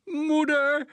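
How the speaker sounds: high piercing scream of a word; screaming boy. A narrator's reading. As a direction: shriek it out in panic. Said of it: "gilde de jongen". (schril gegild)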